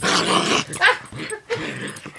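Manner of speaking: piercing squeak. Pretend.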